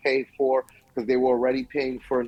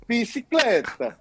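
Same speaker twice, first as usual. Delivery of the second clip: bicicleta said high and slow with a hammed-up Italian lilt; Italian accent; 8 semitones higher, slower than usual. Shown as a demonstration. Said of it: "That's Italian".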